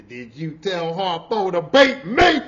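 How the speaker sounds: loud, slow Sofia-style challenge; loud slow Oprah Winfrey imitation; Oprah impression. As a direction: channel Oprah in The Color Purple. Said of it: "Oprah Winfrey when Harpo beat her".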